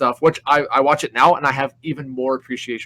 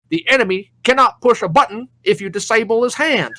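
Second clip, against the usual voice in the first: higher, louder stern lecture; drill sergeant lesson; 9 semitones higher, 4 dB louder than usual. Retold or imitated. Drill sergeant lectures the wounded recruit, higher and louder. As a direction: drive the point home like a drill sergeant. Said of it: "And he's like".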